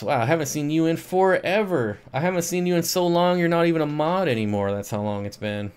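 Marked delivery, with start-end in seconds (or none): 0.0-4.7 excited greeting. Real, not acted. Greeting a returning viewer.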